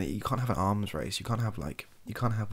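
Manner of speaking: ordinary talk, nothing marked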